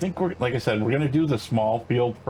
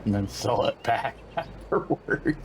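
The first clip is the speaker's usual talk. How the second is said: laughing; 3 dB quieter, slower than usual. Real, not acted. Joking about reselling the lot.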